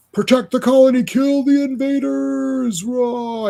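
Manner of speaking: high, loud battle-cry voice; slow, high menace on kill the invaders; comic villain. Acted. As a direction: bark the orders like a raging bug warlord.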